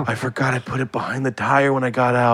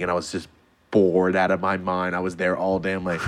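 ordinary delivery

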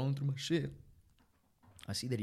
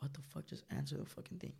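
softly imitated exasperated thought; much softer, half-voiced, as if thinking it; under-the-breath annoyance, retold; hushed exasperation; 2 semitones higher, 11 dB quieter than usual. Retelling exasperated thought as phone rings.

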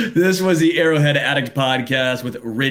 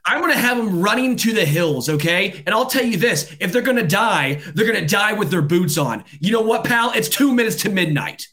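much higher, yelled outrage; shouting rant; 6 semitones higher than usual. Real, not acted. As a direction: let the anger boil over, shouting. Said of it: "can you stop shouting".

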